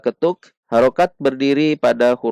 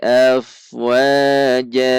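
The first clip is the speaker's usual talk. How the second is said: Quran recitation; 3 semitones higher than usual. Shown as a demonstration. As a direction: recite it measured, stretching each long syllable evenly.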